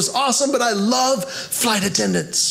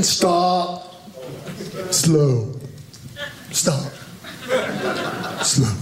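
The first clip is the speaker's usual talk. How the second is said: much lower, slow, bored "Stop. Slow."; bored sign holder; 6 semitones lower, 4 dB quieter, much slower than usual. Retold or imitated. Road crew sign holder droning his words much lower. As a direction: drone the sign words like a tired flagger.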